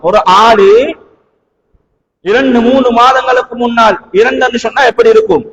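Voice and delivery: normal talking voice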